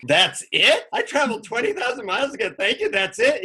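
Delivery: high-pitched, rising mock disbelief; incredulous. Let-down traveler questions the guru after the long trip, acted incredulity. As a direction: protest like you have been cheated. Retold disbelief at the guru's answer.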